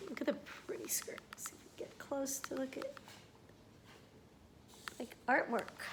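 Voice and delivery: softly whispered aside. Real, not acted. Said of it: "whispering to the child".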